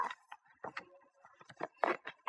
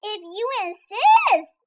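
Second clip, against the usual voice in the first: lobster; 6 semitones higher, 4 dB louder, slower than usual. A narrator's reading. Lobster replies.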